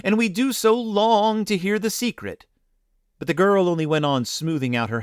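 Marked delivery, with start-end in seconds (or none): 0.0-2.5 higher pleading voices; long to hear it, eagerly; eager plea. Acted. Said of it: "the children cried again".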